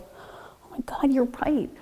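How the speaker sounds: slow, whispered mock admission; whispered realization. Wife conceding to husband she had sulked, slow whispered realization. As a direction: whisper it slowly as it dawns on you.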